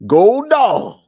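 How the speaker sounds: loud, high rallying shout; team cheer. Real, not acted. Cheering on his Georgia Bulldogs, in a loud, high shout. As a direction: yell it for the Bulldogs.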